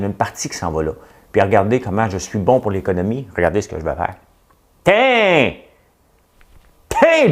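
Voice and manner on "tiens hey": high, sharp Tiens! as if showing off; a cocky gotcha; mock triumph. Fait semblant de payer Google. (Tiens! lancé très aigu, triomphe moqueur)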